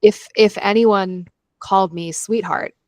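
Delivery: usual speaking voice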